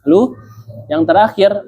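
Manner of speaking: ordinary talk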